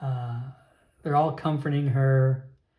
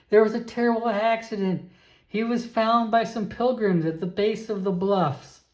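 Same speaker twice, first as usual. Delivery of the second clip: higher-pitched aunt breaking bad news; distraught aunt; 6 semitones higher than usual. Aunt tells nephew of a fatal accident, distraught.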